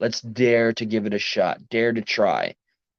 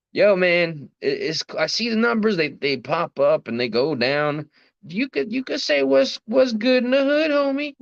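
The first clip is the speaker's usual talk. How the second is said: high, street New York homie voice; New York accent; 10 semitones higher than usual. Pretend. Streamer greeting chat in a put-on New York voice. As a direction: slip into a Brooklyn street greeting. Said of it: "I don't know why I talked like that".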